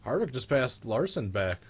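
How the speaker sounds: excited race call. Real, not acted. Race watcher telling friends about the pass, excited call. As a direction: report the pass with a rush of excitement.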